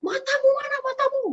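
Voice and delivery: high, far-off driver yelling through traffic; a Medan driver shouting at her on the road; angry Medan driver. Retold or imitated. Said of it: "kalau supir di Medan".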